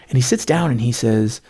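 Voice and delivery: ordinary delivery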